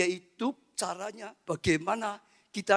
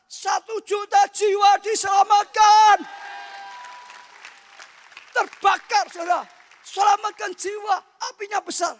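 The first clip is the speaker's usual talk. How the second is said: loud, high-pitched revival preaching; shouting sermon from the pulpit; fiery preaching; 10 semitones higher, 7 dB louder than usual. Acted. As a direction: preach it out at full force. Preacher at full force.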